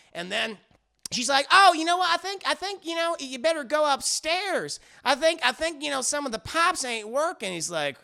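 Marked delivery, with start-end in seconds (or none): 1.5-7.5 high Southern wife, flustered; Bridget. Retold or imitated. Wife tries to send husband upstairs, high Southern accent. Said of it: "She has a very deep Southern accent".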